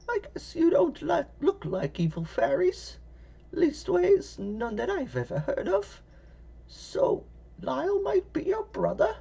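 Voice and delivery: put-on high, creaky old woman voice; little old lady voice.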